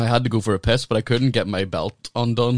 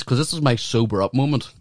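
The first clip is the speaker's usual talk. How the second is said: usual speaking voice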